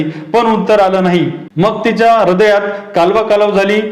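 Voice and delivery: usual speaking voice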